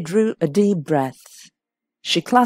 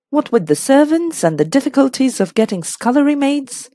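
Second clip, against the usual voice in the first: higher, lively listing of grievances; lightly voiced complaining hostess; 6 semitones higher, 3 dB louder than usual. A narrator's reading. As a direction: grumble through a list of chores.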